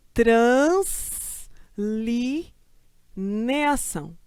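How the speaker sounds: translineação spelled out very slowly, syllable by syllable; slow syllable-by-syllable dictation. Shown as a demonstration. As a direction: drag translineação out slowly with a break at each syllable. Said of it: "separação silábica de".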